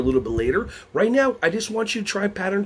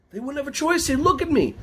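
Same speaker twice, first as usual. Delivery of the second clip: normal talking voice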